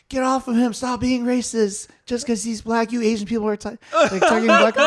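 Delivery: high, loud imitation of an accusing driver; mock angry bystander. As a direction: ham up the self-righteous passerby shouting. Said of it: "stops and is like".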